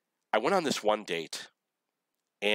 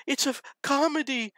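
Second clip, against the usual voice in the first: high, wailing mock sob; hammed-up crying voice of a young woman; imitating crying girl; 3 dB louder than usual. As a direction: imitate her tearful wail, pitched way up. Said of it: "and she's crying".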